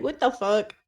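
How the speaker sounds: soft, groggy sick croak; sick sleepy voice. Retold or imitated. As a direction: say it like you're half asleep and ill. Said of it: "I lost my sleepy voice".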